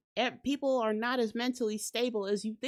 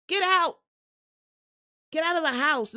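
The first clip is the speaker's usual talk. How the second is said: loud, higher, shooing them out; yelling get out; 5 semitones higher, 6 dB louder than usual. Acted. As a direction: bark them out of the house. Yelling intruders out.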